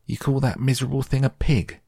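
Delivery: put-on high, quick boyish scoff; scornful. A narrator's reading. Boy belittling his sister's pig, scornful. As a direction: sneer it fast, pitched well up.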